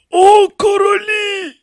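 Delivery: very high, slow, loud invocation; appealing. A narrator's reading. Kneeling priest addresses the ancestors, imploring. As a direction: call out imploringly to a crowd of kings. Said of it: "звернувся він до пращурів".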